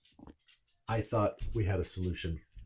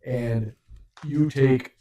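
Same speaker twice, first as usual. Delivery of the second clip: game master narration; 5 semitones higher, 9 dB louder than usual. Acted. GM describes trap springing.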